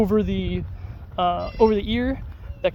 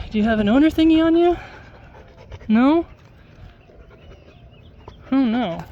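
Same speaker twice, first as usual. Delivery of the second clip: pet talk; 5 semitones higher than usual. Real, not acted. Checking the lost dog's collar.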